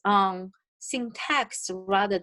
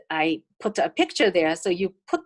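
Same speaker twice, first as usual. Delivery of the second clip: ordinary delivery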